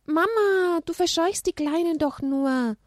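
put-on high whiny child; high childish protest. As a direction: complain to mum.

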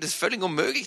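normal talking voice

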